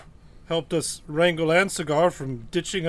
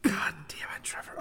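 exasperated whisper; whispered curse; 11 dB quieter than usual. Real, not acted. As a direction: hiss the curse under your breath. Frustrated whisper at a bad roll.

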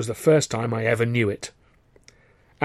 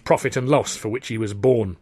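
ordinary delivery

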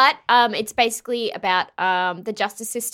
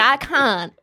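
higher, louder mock despair; mock giving up; 5 semitones higher, 5 dB louder, slower than usual. Telling friends about Scottish accents, comically defeated. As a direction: throw up your hands in comic defeat.

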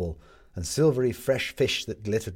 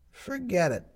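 put-on high girl voice; 10 semitones higher than usual. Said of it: "she said".

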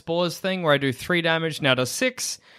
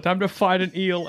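normal talking voice

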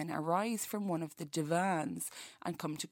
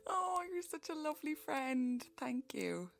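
high voice; 9 semitones higher, 3 dB quieter than usual. Acted. Reader gushes back at alien.

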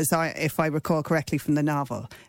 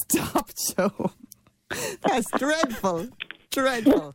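laughing protest; 7 semitones higher, much slower than usual. Real, not acted. Laughing at a bad joke.

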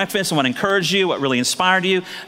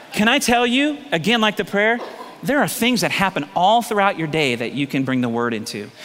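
ordinary talk

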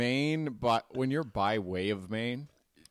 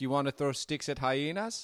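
lightly put-on South African accent; hint of a South African immigrant kid, questioning; 3 semitones higher than usual. South African kid asking schoolmates to play, lightly mock accent. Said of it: "both his parents were South African".

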